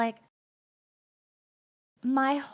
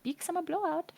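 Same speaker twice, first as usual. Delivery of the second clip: lightly voiced jolly shopkeeper; a hint of the Frozen trading-post guy; 4 semitones higher, 6 dB quieter than usual. Friend quoting a Frozen shopkeeper, lightly voiced. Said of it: "the guy from Frozen where he's like".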